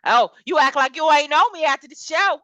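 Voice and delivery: mock going off. Imitating a woman who dropped her baby voice, mock angry. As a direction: go off on them, in mock indignation. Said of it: "She started getting off".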